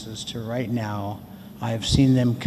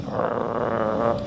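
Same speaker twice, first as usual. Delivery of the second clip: rolled growl like the dog; imitated dog growl; 3 semitones lower, 3 dB quieter than usual. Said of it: "my dog is going".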